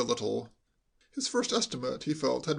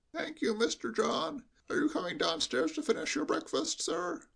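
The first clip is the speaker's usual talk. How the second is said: put-on an old servant bleating every word; bleating old butler; 11 semitones higher, 3 dB quieter than usual. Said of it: "bleated like a sheep".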